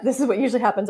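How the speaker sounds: usual speaking voice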